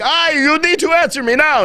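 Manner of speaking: high-pitched indignant demand; imitating pissed reporter. Reporter demanding an answer from the press secretary, pissed. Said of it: "he was pissed".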